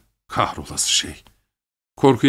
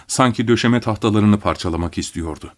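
usual speaking voice

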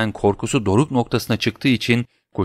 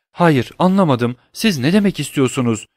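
mumbled; 6 semitones higher, 3 dB louder than usual. Acted. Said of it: "diye geveledi".

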